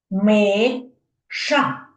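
Миша with Ми held and stressed; stress on first syllable. Shown as a demonstration. Teacher to children, stressing the first syllable of Миша. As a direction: lean on the Ми in Миша. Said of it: "Ви чуєте, як я голосом виділяю".

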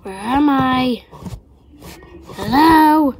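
slow, loud, worried call; lost in the dark, calling for help; scared calling out. Acted. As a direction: call out, unsure where you are.

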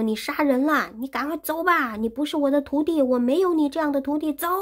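furious master, fast and high; angry banishing. Acted. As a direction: drive the disciple away in fury. Tang Seng angrily banishes Wukong.

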